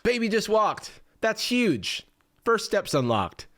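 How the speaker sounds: starts high, drops back to a matter-of-fact read; restrained congratulations; toned-down chatbot. Acted. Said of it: "Versus G P T five".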